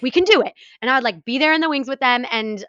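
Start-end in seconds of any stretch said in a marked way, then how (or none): 0.0-0.5 higher and louder cheer; rallying cheer. Retold or imitated. Backstage to the kids, higher and louder. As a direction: fire them up. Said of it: "I was like".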